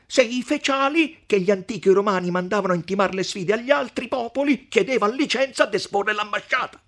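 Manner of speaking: the mayor challenging the count with history; pedantic podestà. Acted. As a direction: show off your Roman learning. Podestà cites Roman heralds.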